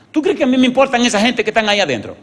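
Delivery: high, loud, rushed retort; indignant outburst. Retold or imitated. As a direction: erupt at him in one fast breath. Doctor's indignant outburst at driver.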